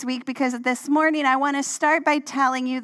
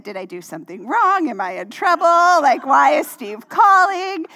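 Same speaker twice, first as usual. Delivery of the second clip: rising alarm, ending in a louder, higher burst; mock panic; 3 semitones higher, 7 dB louder than usual. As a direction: replay the worry as it builds to a cry. Retelling own panic at phone call.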